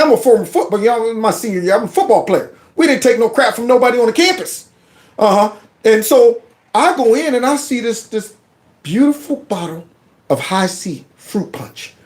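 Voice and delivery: ordinary talk, nothing marked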